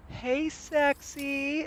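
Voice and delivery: high, drawn-out catcall; mock scream. Telling friends how he catcalled out the window, mock scream. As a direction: holler a long, high catcall. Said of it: "I scream out the window".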